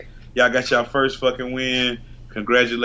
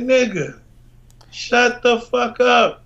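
high, slow, drawn-out put-down; exasperated retort; 11 semitones higher, much slower than usual. Retold or imitated. Host retells telling Trump off, high and slow. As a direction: stretch it out, fed up. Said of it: "I'm just like".

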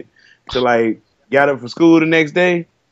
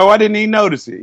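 higher-pitched mock innocence; a hint of an oblivious mother; lightly voiced mama; 8 semitones higher, faster than usual. Mama answering the teacher about the haircut, lightly mocked innocence. Mama's reply to teacher, retold.

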